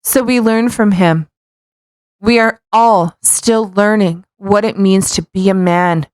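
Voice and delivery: ordinary delivery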